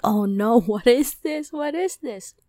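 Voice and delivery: high, loud, startled with a laugh; alarmed laughing cry. Retold or imitated. Panicked at strange dark liquid.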